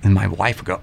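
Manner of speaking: ordinary talk, nothing marked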